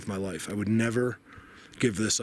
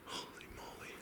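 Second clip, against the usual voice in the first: barely voiced whisper of awe; hushed astonishment; awed whisper; 20 dB quieter than usual. Real, not acted. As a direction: breathe it out in amazement. Sees something in the forest.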